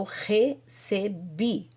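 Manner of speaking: "recebi" slowly split as re-ce-bi; syllable by syllable. Shown as a demonstration. Teacher reminding students what lekabel means, syllable by syllable. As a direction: space out each syllable of "recebi". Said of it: "O que que é lekabel?".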